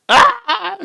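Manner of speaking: high mock yelp amid laughter; comic high "Ah!"; imitated startled scream. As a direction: shriek in fake fright, then crack up. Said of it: "His reaction".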